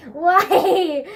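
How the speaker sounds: loud, high laughing wail; laughing mock dismay. Friend posted the ugly picture, laughing mock dismay. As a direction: protest through laughter.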